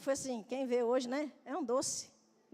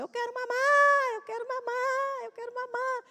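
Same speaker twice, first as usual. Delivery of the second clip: put-on high, slow, drawn-out child's plea; whining toddler; 9 semitones higher, 4 dB louder, slower than usual. Mother imitates her daughter begging to nurse, high slow whine. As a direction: whine it like a small girl begging at night. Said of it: "E ela começou".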